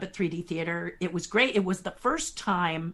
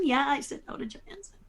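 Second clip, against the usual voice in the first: quick, softer, falling confirmation; matter-of-fact; 6 semitones higher, 4 dB quieter, faster than usual. Real, not acted. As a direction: shrug it off as obvious. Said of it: "And people go".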